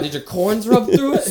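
high, laughing shout; loud mock roast. Friend roasting John's holey socks, high laughing jeer. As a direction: heckle him through laughter. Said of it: "Us roasting John's fucking baby socks".

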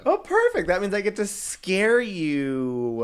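high then slow sinister glee; gleeful villain. Pretend. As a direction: savor scaring them. Gets to scare co-host.

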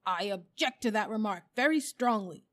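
loud, emphatic objection; indignant bulldog. A narrator's reading. Bulldog objects to elephant's remark on noses, indignant. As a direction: protest firmly, with offended force.

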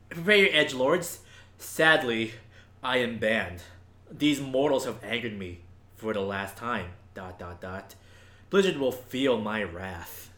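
lightly voiced low villain boast; a hint of a brooding villain; enraged boss roar. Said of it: "Okay, Reaper".

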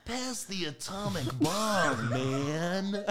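high, drawn-out stoner voice; mock stoner. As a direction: stretch it out like a stoner passing the bong. Riffing as an atomic bong smoker.

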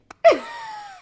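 a burst of high, loud laughter. Real, not acted. Joking about a funny tweet.

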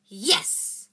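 loud, exultant yes; triumphant cry. A narrator's reading. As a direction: cheer it out in triumph. Triumph after locking the troll in.

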